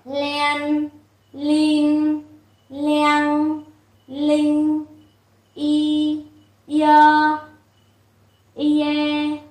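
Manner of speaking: each syllable called out clearly with a pause after it; teacher leads the class through a repeat drill of syllables; read-along drill. Shown as a demonstration. Giáo viên đọc mẫu từng âm cho học viên đọc theo. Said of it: "Các bạn đọc lại một lần nữa".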